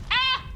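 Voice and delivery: short, high, loud "ah"; startled yell. Real, not acted. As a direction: yelp in sudden fright. Startled by game.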